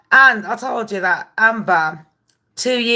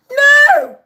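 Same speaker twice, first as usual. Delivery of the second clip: put-on squeaky child denial; 6 dB louder than usual. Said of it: "it's like".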